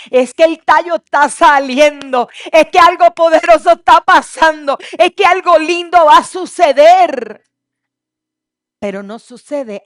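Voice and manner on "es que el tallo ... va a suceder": loud, ringing sermon peak; loud preaching crescendo; preaching climax. Acted. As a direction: lift the room with each line. Preaching at full force.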